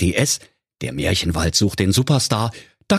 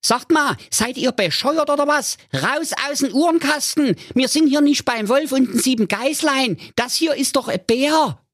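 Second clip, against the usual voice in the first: very high, squeaky scolding; mother telling off her silly kids in a cartoon squeak; exasperated comic mother, pitched way up. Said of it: "Die Mutter Keule sprach".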